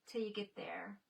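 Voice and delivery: whisper. Real, not acted. Musing softly to herself.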